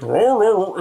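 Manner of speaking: Bane voice imitation. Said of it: "He sounded like".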